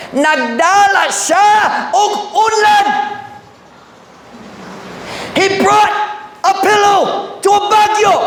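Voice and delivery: high, slow preacher's shout; preaching at full force. Acted. Pastor telling the church Jesus brought a pillow, preaching at full force. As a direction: drive the point home with a ringing cry.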